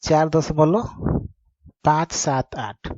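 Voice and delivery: "पाँच सात आठ" spoken slowly as three separate digits; slow, careful "चार दशमलव पाँच सात आठ", one digit at a time; correct pronunciation. Shown as a demonstration. Teacher gives students the right pronunciation, slow separate digits. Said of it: "सही उच्चारण क्या है".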